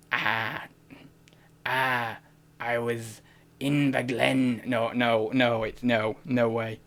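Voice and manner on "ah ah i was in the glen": low, hesitant try at a Scottish accent. Shown as a demonstration. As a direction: feel around for a Scottish accent. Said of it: "I can't do Scottish".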